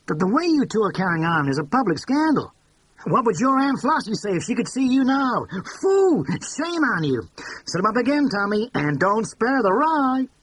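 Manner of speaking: high, loud mock scolding of the lovebirds; brassy scolding. A narrator's reading. Tilly teasing Monica and Bill at the bar, loud mock scolding. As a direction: cluck at them like a scandalized aunt, then call the bartender.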